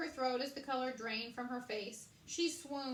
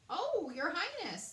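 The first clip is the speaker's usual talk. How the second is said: rat's greeting; 8 semitones higher, 5 dB louder than usual. A narrator's reading. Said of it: "the rat greeted".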